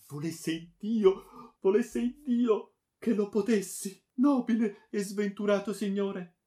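high, loud, pleading voice; anguished lament. A narrator's reading. Friar pitying the unfortunate baron, lamenting. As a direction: lament it with rising pitch.

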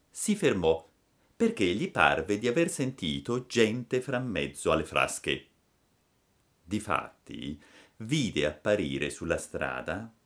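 ordinary delivery

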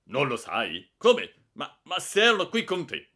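loud, high barking questions; bully the culprit's names out of him; furious interrogation. Acted. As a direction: director demanding who smoked, furious. Director disbelieving and angry.

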